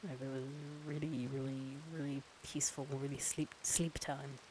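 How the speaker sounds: soft, low sleepytime murmur; mock ASMR. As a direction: lull them close to the mic.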